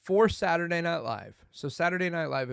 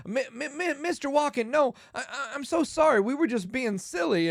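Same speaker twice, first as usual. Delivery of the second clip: put-on high, loud, stammering teenager; flustered apology; 10 semitones higher, 5 dB louder than usual. Shoe store worker apologizing to movie star, flustered. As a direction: trip over the words, mock-panicked.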